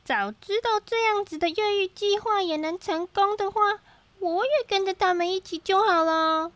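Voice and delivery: high, wistful self-talk; talking to himself. A narrator's reading. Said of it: "他自言自语道".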